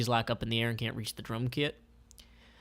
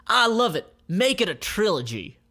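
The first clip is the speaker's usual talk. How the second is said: loud, high delighted shout; thrilled CEO; 8 semitones higher, 6 dB louder than usual. Acted. June Carmoli loving the pitch, thrilled. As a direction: burst out with delight. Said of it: "throwing her hands up".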